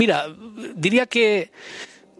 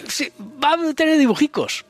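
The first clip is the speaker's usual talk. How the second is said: playful high voice; 11 semitones higher than usual. Acted. Teasing about a special edition.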